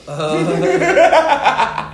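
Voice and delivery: cracking up mid-sentence; loud laughter breaking through; laughing. Real, not acted.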